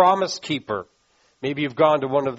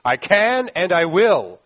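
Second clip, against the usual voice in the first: slow, loud, higher declaration; absolute certainty; 4 semitones higher, 6 dB louder, slower than usual. Shown as a demonstration. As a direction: lead the room in a firm vow. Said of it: "Say it with me".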